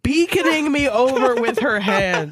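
reading through giggles; can barely get the line out for laughing; laughing while reading. Real, not acted. Reader cracking up at the bad writing, laughing through words.

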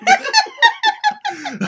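high shrieking laugh; high squealing laugh. Real, not acted. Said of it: "That's Scottish".

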